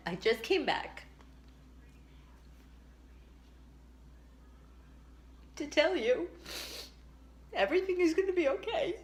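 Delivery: tearful, high and breaking voice; crying. Real, not acted. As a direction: choke up through the words. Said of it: "this could make me cry".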